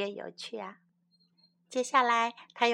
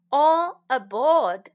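slow, loud boarding call; a ferryman's loud, drawn-out call; 2 semitones higher, 8 dB louder, slower than usual. Shown as a demonstration. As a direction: call everyone onto the boat. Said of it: "teacher calls the phrase".

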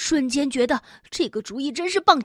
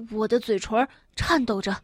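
ordinary delivery

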